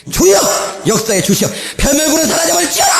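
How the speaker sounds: preacher rebuking pests, booming; commanding prayer. Retold or imitated. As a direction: cast out the pests with full voice. Retold bold prayer against pests.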